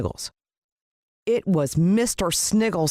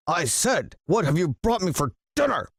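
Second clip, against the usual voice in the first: gruff villain demanding; 3 semitones higher than usual. A narrator's reading. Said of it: "screamed Mister Sniggles".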